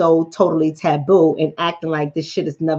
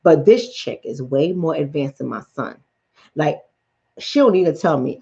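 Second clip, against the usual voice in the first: ordinary talk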